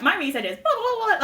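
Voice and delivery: mock research pitch, rising through the blahs; rehearsed spiel. Student imitating her first-year pitch, rehearsed spiel. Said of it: "you get it down".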